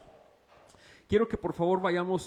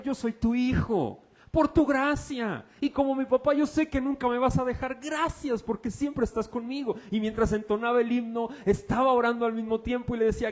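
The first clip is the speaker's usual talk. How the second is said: high, loud, rapturous pulpit testimony; preacher reliving a prayer during worship; ecstatic preaching; 8 semitones higher, 3 dB louder than usual. Acted. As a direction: carry the congregation with joyful fervor. Rapturous retelling of hymn prayer.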